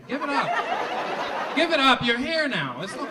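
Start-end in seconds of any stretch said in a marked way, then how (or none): 0.1-1.6 stretched very slow and high, coaxing; drawn out. Shown as a demonstration. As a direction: drag it out slowly to calm them. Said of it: "Why y'all so angry?".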